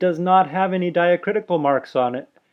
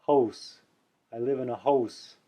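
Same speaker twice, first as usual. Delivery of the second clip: put-on French Canadian accent. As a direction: mimic a French Canadian saying hose for house. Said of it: "If you listen to a French Canadian, they will say".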